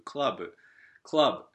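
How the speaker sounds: クラブ in showy English pronunciation; かっこいい発音. Shown as a demonstration. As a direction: say クラブ as a cool English word. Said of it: "これをかっこよく". (英語風にかっこよく「クラブ」)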